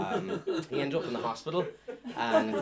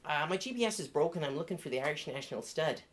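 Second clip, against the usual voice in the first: bad Irish accent; 6 semitones higher than usual. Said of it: "She wasn't very good at the accent".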